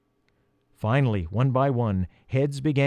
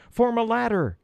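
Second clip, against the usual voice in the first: yelling; 10 semitones higher than usual. A narrator's reading. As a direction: call out an order to the group. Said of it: "yelled Peter".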